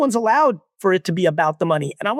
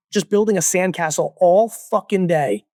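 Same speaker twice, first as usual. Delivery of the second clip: usual speaking voice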